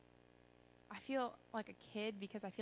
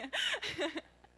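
high, loud burst of laughter; high giggling fit after a slip; real laugh; 11 semitones higher, 5 dB louder than usual. Real, not acted. Laughs at own false start.

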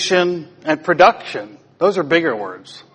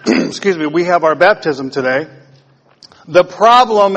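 ordinary talk, nothing marked

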